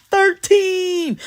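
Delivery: high wailing mock cry; brief hammed-up crying sound; demo crying. Shown as a demonstration. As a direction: wail the word like a fake sob. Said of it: "crying like this".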